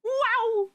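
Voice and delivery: high-pitched "wow" whoop; high cartoon launch noise; Mario-style launch whoop. Shown as a demonstration. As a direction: whoop like a game character launching. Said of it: "Any of my launches".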